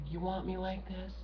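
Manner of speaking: sarcastic hush, daring them to listen; quiet voice. Shown as a demonstration. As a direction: drop to a soft near-whisper to make a point. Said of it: "or then how about when I'm quiet?".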